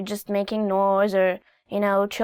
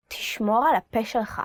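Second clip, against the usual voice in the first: fast, clipped Hebrew rattled off; quick warning; much faster than usual. Shown as a demonstration. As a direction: toss off תשמור על הפה שלך quickly. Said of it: "demo of warning phrase".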